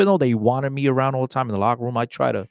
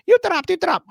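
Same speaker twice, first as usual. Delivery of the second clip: high-pitched mocking mimicry; 4 dB louder, much faster than usual. Female reporter mocking at the press conference, mocking.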